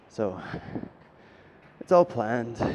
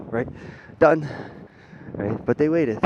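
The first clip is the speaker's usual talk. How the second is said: ordinary delivery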